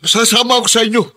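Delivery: panicked, giving up at once; frightened pleading. Acted. Backs down, frightened. (takot na pagsuko)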